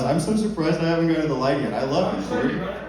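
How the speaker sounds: ordinary talk, nothing marked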